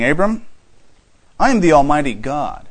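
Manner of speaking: a mildly reproving God, pressing each word; God looking at Abraham's behavior, sternly reproving; mild reproof. Shown as a demonstration. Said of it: "just mildly reproving Abram here".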